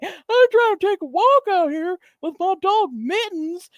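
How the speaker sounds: put-on high, reedy old man voice scolding; old man impression. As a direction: whine about the walk with Mittens. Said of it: "he's like".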